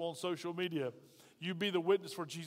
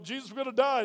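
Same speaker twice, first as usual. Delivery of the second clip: higher, louder panicked cry; 6 semitones higher, 6 dB louder than usual. Acted. Disciples waking Jesus in the storm, high and loud in panic. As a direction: yell in terror over the storm.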